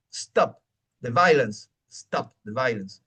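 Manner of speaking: "stop" begun straight on the S; "stop the violence" with no E before "stop"; no ghost E. Shown as a demonstration. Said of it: "es stop".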